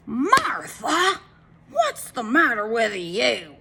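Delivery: high, slow, loud yell at his wife; yelling husband. A narrator's reading. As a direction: call out at Martha, exasperated. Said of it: "yelled Mister Wagon Wheel".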